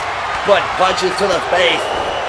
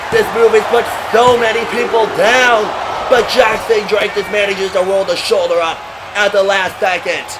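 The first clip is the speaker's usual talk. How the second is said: excited; 3 semitones higher than usual. Acted. Big splash and near-fall.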